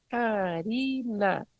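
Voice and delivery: slow syllable-by-syllable Karina; name clapped by syllable. Shown as a demonstration. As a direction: clap out each syllable of "Karina" slowly. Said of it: "vou, meu nome". ("Karina" falado bem devagar, sílaba por sílaba)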